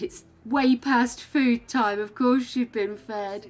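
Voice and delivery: retold telling-off. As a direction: replay the stern line to the dog. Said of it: "And I said".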